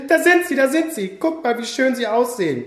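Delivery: high, eager cries of nimm mich; excited shouting. Acted. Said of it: "schrie ein Love-Teddy von ganz oben".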